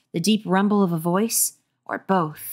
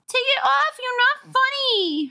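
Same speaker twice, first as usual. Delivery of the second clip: upset shout. Acted. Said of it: "her brother shouted".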